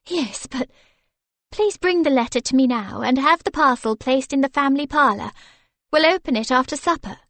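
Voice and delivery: bubbling over with good news; delighted lady. Acted. Lady excited about a parcel from her sister, quick and bright.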